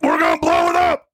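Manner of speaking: high, panicked mock shout; hammed-up screaming freak-out. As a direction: yell it like a passenger losing it. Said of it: "freaks the fuck out and be like".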